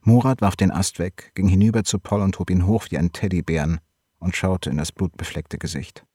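plain reading voice

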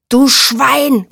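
furious high roar; roared insult. A narrator's reading. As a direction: roar it in outrage. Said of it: "brüllte Erkan".

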